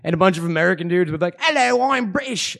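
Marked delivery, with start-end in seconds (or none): 1.4-2.6 high-pitched, hammed-up British accent; mock a bad British accent; fake British accent. Podcaster mocking American dudes faking British accents, hammed-up British accent. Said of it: "accents and shit".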